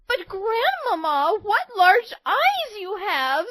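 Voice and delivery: put-on high, slow amazed girl voice; surprised girl. A narrator's reading. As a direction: marvel like a little girl. Girl marvels.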